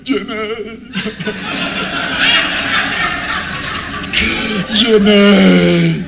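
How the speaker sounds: high mock sob building to a loud wail; hammed-up weeping. Man imitating himself watching Forrest Gump, mock weeping. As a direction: bawl the name out, comically overdone. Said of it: "I was a little emotional".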